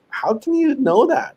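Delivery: high-pitched mock disbelief; astonished reaction. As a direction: squeal it like someone amazed. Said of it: "he would be like".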